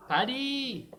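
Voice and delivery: loud, high yell across the trench; a high call into the silence. Acted. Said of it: "and he called out".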